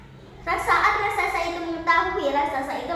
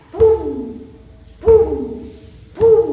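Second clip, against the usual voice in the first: three loud, punched booms, pitched up; booming footsteps; 12 dB louder, much slower than usual. Acted. Storyteller imitates the giant's earth-shaking arrival, booming. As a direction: boom out each heavy thud.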